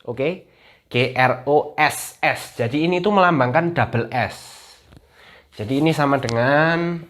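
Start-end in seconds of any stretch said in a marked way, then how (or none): 0.9-2.6 letters G R O S S spelled slowly; slow spelling. Shown as a demonstration. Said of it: "spelling demo". (mengeja huruf satu per satu)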